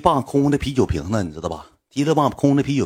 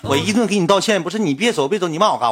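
higher-pitched, indignant retold objection; aggrieved protest; 6 semitones higher than usual. Apologizer objects to being cursed at, aggrieved protest. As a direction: protest the unfair cursing without shouting.

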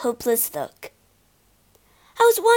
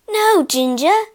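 louder and higher, pleading with a friend; louder, higher cry of protest; 7 semitones higher, 6 dB louder than usual. A narrator's reading. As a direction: call out to stop her giving up. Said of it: "I cried".

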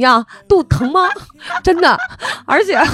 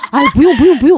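husband refusing; 4 semitones higher, 7 dB louder, faster than usual. Retold or imitated. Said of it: "他说".

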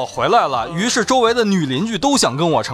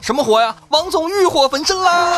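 teasing shout; 14 semitones higher than usual. Pretend. Mock shout teasing a colleague.

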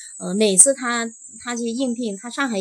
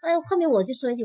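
ordinary talk

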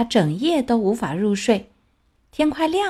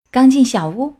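ordinary delivery, nothing marked

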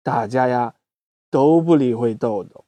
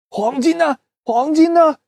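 out of breath; 11 semitones higher than usual. Acted. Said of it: "气喘吁吁地问".

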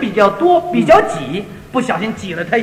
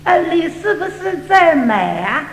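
Cantonese woman; 4 semitones higher, slower than usual. Retold or imitated. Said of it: "我给你学学".

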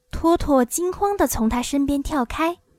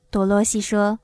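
ordinary delivery

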